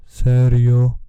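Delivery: "Serio" boomed out loud and drawn out in a bass voice; bassy voice. Shown as a demonstration. Vlogger showing off his bass on "Serio", booming bassy voice. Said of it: "Fajny basik".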